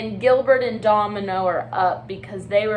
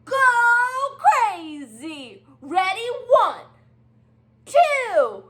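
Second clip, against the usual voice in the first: eager countdown; 12 semitones higher, 4 dB louder than usual. Acted. Penguin eggs on a shout.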